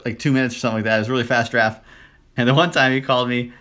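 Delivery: ordinary talk, nothing marked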